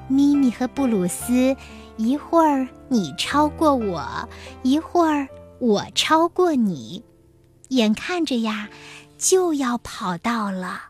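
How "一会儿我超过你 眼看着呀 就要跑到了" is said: usual speaking voice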